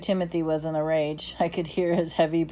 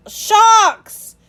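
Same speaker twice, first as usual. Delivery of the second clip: very high, loud terrified yell; frightened roar; 12 dB louder than usual. A narrator's reading. Timothy warning the boy in the water, frightened roar. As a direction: scream the warning in terror. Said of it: "Timothy's frightened roar".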